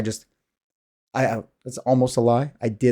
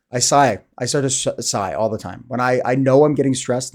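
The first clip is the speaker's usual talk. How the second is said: ordinary delivery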